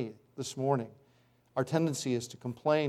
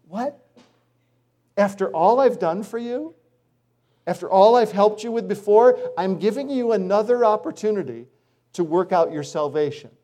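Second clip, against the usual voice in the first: higher, incredulous disappointed voice; exasperated God; 4 semitones higher, 4 dB louder than usual. Pretend. God looking down at believers, exasperated and disappointed. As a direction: sound let down after all you gave.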